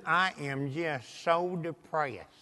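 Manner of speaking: slow, low, soft imitation of a dejected woman; depressed. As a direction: drag it out, sunk and quiet. Retelling a depressed lady's complaint.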